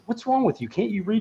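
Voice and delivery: high incredulous cop voice; mock detective. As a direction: needle him like an impatient cop. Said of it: "he goes".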